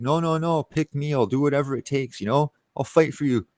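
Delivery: high, whiny mock begging; mock pleading. Mocking his younger self begging the girl to pick him, high and whiny.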